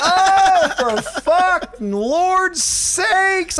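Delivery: exasperated groan. Retold or imitated. Comic reacting to a gushing DJ, drawn-out exasperated groan. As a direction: drag it out in fed-up disgust. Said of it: "And I'm like".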